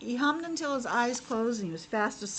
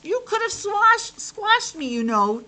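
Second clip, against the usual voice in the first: much higher, louder, slow cranky frog voice; grumpy frog; 10 semitones higher, 7 dB louder, slower than usual. Acted. Grumpy frog complains to the piglet, high cranky voice. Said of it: "said the frog".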